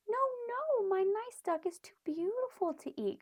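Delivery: put-on high, loud pleading boy; crying out. A narrator's reading. Boy begging parents not to eat Ping, high loud plea. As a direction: plead high for the duck's life. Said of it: "cried the boy".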